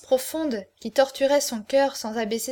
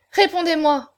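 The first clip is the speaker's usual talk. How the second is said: raised, louder demand; crying out; 6 semitones higher, 6 dB louder than usual. A narrator's reading. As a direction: cry out for an answer, insistently. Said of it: "s'écria Aurore". (exclamation forte et pressante)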